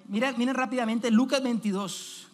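ordinary talk

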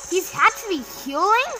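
high-pitched disbelief, rising at the end; incredulous question. Real, not acted. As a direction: ask it in rising astonishment. Disbelief as enemy heals.

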